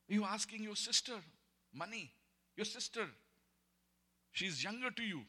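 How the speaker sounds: high, loud indignant sister; scolding sister. Retold or imitated. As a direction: pitch up and scold like the annoyed sister. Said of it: "She said".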